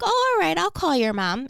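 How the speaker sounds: high, bright going-along voice on the oh; sing-song mock-cheerful agreement; cheery reassurance. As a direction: cheerfully humor her like it's no big deal. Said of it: "I'd be like".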